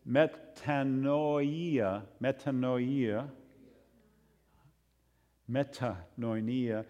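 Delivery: metanoia spelled out slowly, me-ta-NOI-a; teaching the Greek metanoia, slow and deliberate; Greek word said slowly. Shown as a demonstration. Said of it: "the word for repentance is metanoia".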